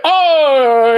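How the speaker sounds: long, high exasperated "oy"; drawn-out comic Yiddish groan; Jackie Mason. Acted. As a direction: wail it out in comic despair. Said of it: "a bit of a Jackie Mason".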